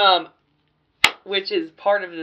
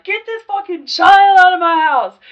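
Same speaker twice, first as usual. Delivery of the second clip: much higher, louder cracked furious scream; 13 semitones higher, 14 dB louder than usual. Retold or imitated. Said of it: "I apologize for screaming".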